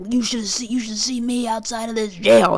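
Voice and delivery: starts as a whisper, then swells into a taunt; menacing jailhouse boast. Retold or imitated. Convict boasting to a fellow inmate, whisper rising to a taunt. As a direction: hiss the threat, then let it grow into a brag. Joe Exotic boasting from jail.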